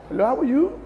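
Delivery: light, laughing imitation of a put-on London accent; mock fake London accent. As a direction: tease someone faking a London accent on their return. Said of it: "you say".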